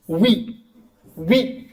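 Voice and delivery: ordinary delivery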